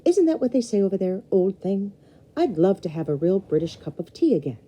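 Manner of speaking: cheerful uncle, lightly played; Uncle Gideon. Uncle chatting cheerfully to the Graham, lightly voiced. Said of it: "said Uncle Gideon".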